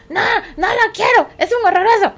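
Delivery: put-on high, loud angry kid; angry child. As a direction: snap back like a furious kid. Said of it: "niño enojado responde". (niño enojado, agudo y fuerte)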